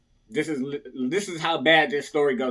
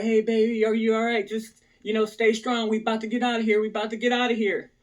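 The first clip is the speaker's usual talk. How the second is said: higher-pitched imitation of a reassuring girlfriend; mock girlfriend voice, lifted high; girlfriend's encouragement; 6 semitones higher, faster than usual. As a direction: voice your girlfriend urging him to hold on. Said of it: "my girl's like".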